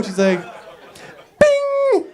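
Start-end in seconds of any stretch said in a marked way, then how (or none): 1.4-2.0 very high, ringing chime; ping like a finished machine; machine bing. Acted. Woman finishes calibrating him, high ringing bing.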